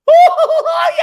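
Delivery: high, loud laughing whoop; cracking up on the call; laughing burst. Retold or imitated. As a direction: blurt it out mid-laugh. Colleague laughing after being caught.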